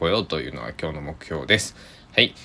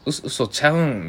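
Kansai dialect; 9 semitones higher, 3 dB louder than usual. Retold or imitated. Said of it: "関西弁だと".

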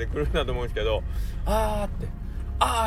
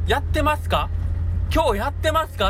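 high eager caller voice; caller asking; 5 dB louder than usual. Retold or imitated. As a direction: ask like a customer on the phone. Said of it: "っていう電話も".

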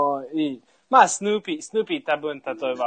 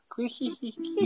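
high, slow witchy cackle; old hag cackle; 8 semitones higher, 9 dB quieter, slower than usual. Retold or imitated. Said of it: "そんな感じだね".

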